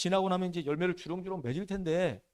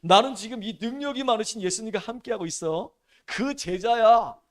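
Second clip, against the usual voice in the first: swaggering boast; 4 semitones higher, 6 dB louder than usual. Pretend. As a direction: puff up and stretch the last words. Disciple shows off being chosen.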